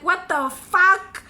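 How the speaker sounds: loud, stretched-out mock disbelief; appalled disbelief. Retold shocked reaction to the pet name.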